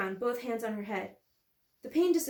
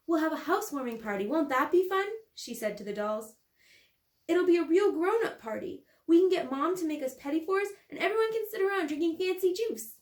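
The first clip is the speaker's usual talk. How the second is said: excited; 6 semitones higher, 4 dB louder than usual. Acted. Girl playing with dolls.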